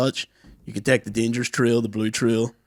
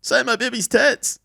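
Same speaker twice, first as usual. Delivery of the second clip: much higher, slow squeaky pleading voice; hammed-up begging parent voice; a mother begs the president to kiss her baby; mock baby-kisser plea; 12 semitones higher, slower than usual. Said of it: "be like".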